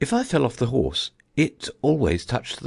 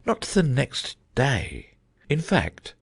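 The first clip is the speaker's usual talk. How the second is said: slow and musing, higher; slow, thoughtful; 5 semitones higher, slower than usual. A narrator's reading. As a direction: drag it out, lost in thought. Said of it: "repeated as before".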